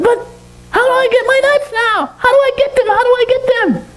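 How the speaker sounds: very high squeaky monkey voice; frantic falsetto monkey questions; demanding monkey. Acted. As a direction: squeal insistently as a frustrated little monkey.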